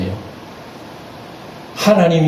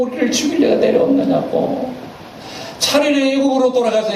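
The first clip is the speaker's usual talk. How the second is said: whiny complaint; 10 semitones higher than usual. Retold or imitated. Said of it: "목마르다고".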